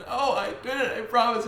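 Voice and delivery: high, whimpering retold plea; mock tearful plea. Crying kid answers the teacher's question, mock tearful. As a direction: imitate a pleading, weepy child.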